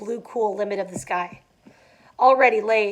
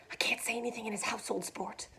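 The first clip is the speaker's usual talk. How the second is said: much softer whispered aside; 13 dB quieter than usual. A narrator's reading. Gatsby to the narrator, whispering out of Tom's earshot. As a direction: whisper so he won't hear.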